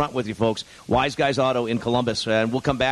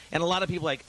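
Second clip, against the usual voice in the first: normal talking voice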